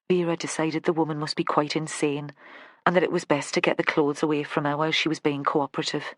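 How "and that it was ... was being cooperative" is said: plain reading voice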